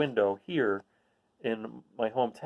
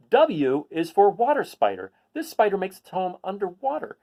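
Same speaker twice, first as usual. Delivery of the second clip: picture-book read-aloud voice; reading to children; 4 semitones higher, 3 dB louder than usual. Acted. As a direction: read it to a class of kids.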